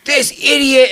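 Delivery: going off. Retold or imitated. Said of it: "I go off on this camera guy".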